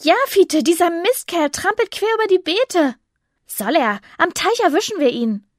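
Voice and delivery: hohe, eifrige Mädchenstimme; kesses Mädchen. Acted. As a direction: trotzig die Jagd anfeuern. Tizi als Mädchenfigur. (kesse Mädchenstimme)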